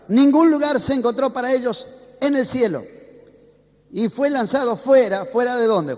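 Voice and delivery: loud, driving sermon delivery that calls the congregation to answer; preaching at full force. Acted. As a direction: preach it out to the church with fervor. (predicando con fervor y en voz alta)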